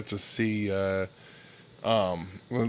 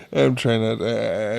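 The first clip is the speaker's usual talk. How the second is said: ordinary talk, nothing marked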